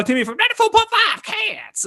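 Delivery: very high, squealed station jingle voice; hammed-up radio station call. Host naming a guest's radio station, hammed-up high voice. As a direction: blurt the station name in a silly falsetto.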